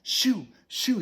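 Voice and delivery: loud, high shooing shout. Acted. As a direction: shoo him off loudly. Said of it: "shouted his mother".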